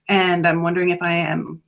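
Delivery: usual speaking voice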